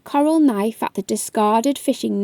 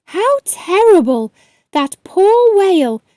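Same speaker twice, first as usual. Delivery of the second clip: shocked; 5 semitones higher, 4 dB louder than usual. Acted. Kelly hears of trapped whale.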